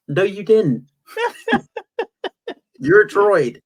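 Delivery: mock scolding that breaks into laughter; laughing. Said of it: "It's like".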